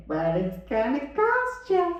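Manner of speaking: slow, high, drawn-out warning; put-on voice, pitch climbing slowly; shelter lady. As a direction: imitate the shelter lady stretching out the catch. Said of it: "She said".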